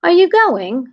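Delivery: put-on high-pitched British accent, lifting sharply; loud, swooping British "Are you going?"; British rising question. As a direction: imitate a Brit asking, pitch way up. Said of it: "Their accent goes up an octave".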